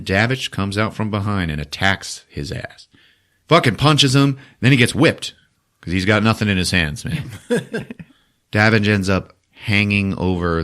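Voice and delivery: usual speaking voice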